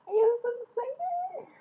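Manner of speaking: very high, teary squeal of joy; overwhelmed fan squeal; excited squeal. Real, not acted. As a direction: squeal it, near happy tears. Unboxing her Army Bomb.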